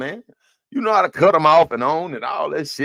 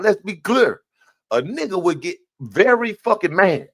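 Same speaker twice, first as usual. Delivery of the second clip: ordinary talk, nothing marked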